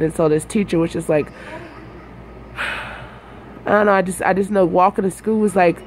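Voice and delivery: ordinary talk, nothing marked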